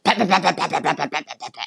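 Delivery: a light imitation of guys babbling aggressively; mock aggressive chatter. Imitating the aggressive guys talking to each other, lightly mocking. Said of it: "everybody's so aggressive here".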